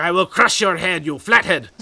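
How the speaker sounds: loud mock head-crusher voice; lightly voiced sketch character threat; Kids in the Hall crusher. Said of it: "that thing from the kids in the hall".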